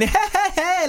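high mocking group laugh; imitated giggling. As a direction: mimic everyone giggling, very high-pitched. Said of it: "everyone around starts giggling".

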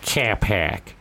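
teasing imitation of a Boston accent. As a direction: mimic a Boston accent for a joke. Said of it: "play someone from Boston".

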